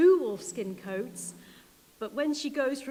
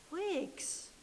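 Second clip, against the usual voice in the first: usual speaking voice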